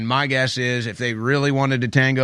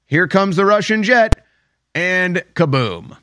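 mock jet strike; 5 semitones higher, slower than usual. Shown as a demonstration.

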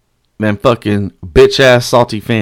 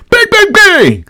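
very high, loud mimicked bangs; high-pitched banging; 5 dB louder, slower than usual. Fan imitating thunder sticks at security, shrill high chant.

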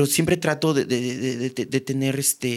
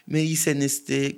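ordinary talk

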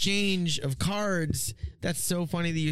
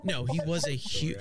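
ordinary delivery

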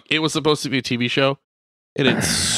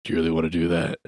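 much lower, softer menacing voice; deep Blade voice; 9 semitones lower, 4 dB quieter, faster than usual. Retold or imitated. Hidden Blade warns the hero, low menacing voice. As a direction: growl it like a shadowy vampire hunter. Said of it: "you hear Blade go".

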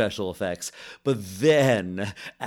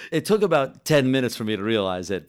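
ordinary delivery

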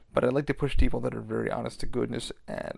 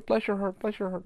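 lightly imitated wife, high and softer; mock grateful wife; 8 semitones higher, 4 dB quieter than usual. Drunk man's wife to the worker, imitated cooing. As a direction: coo it in a higher mock voice. Mimics the wife's sugary reply.

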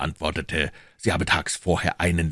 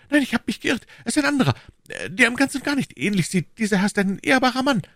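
high-pitched, flustered shopkeeper backtracking; Bonacieux; 3 dB louder than usual. A narrator's reading. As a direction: take it back hastily in a high voice. (hoher, aufgeregter Krämer)

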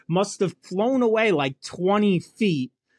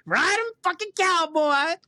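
high whooping cowboy cheer; gleeful high yee-haw; mock rodeo whoop; 12 semitones higher than usual. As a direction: yell it like riding a bucking bronco. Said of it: "It's like".

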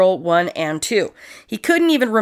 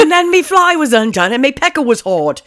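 hammed-up British accent; 5 semitones higher than usual. Host mocking the killer's story in a hammed-up British accent. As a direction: ham up a rough British bloke.

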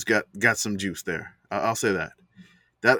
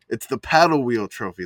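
normal talking voice

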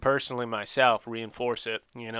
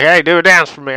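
loud call-out; 6 semitones higher, 15 dB louder than usual. Retold or imitated. Calling out to the raver.

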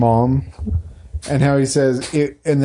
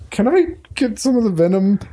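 slimy Slughorn; 9 semitones higher than usual. Retold or imitated. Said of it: "Slughorn is such a slimy snake".

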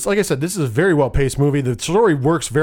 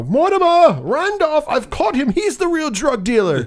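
frantic accusing; 10 semitones higher than usual. Retold or imitated. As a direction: squeal it out like a desperate snitch. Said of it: "he's like".

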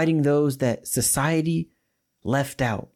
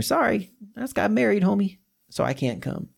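higher, breezy brush-off to a friend; casual excuse; 7 semitones higher than usual. Retold or imitated. Preacher voicing a newlywed excuse, breezy. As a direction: toss off the excuse like to a homie. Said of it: "I can kinda relate to this person".

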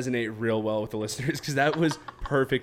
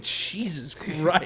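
drawn-out, higher mock shock; retold astonishment; 6 semitones higher, much slower than usual. Friend retells reacting to Caitlin's answer, mock astonished. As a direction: stretch it out slowly in playful disbelief.

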